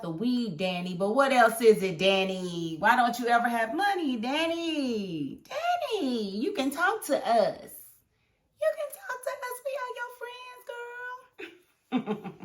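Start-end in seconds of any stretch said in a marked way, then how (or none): 2.8-7.7 a hint of a sing-song caring friend; mock concern. Fan pretends to counsel broke Danny, mock caring. 8.6-12.3 very high, sweet put-on voice; mock caring friend. Fan teases Danny in a high sugary voice.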